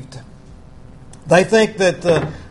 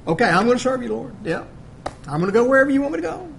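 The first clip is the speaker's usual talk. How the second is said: quick, eager, pitched up; eager promise; 5 semitones higher, 3 dB quieter, much faster than usual. Retold or imitated. Eager follower hurriedly promising to serve the Lord. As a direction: rush the pledge like an eager follower. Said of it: "the certain man said".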